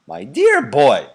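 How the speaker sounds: put-on loud, slow old man; an old man addressing his grandson; slow loud grandfatherly voice; Grandpa Joe. A narrator's reading.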